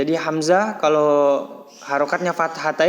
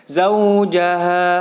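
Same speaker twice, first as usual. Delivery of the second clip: "zaujaha" chanted slowly, louder, with pressed "zau"; pressed recitation; 3 semitones higher, 5 dB louder, much slower than usual. Shown as a demonstration. Teacher models a Quran word for pupils, pressed recitation. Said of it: "ditekan membacanya, bukan panjang".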